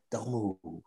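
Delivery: Tommy hushes Kat not to move; hushed warning. Retold or imitated. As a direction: warn her to freeze, very softly.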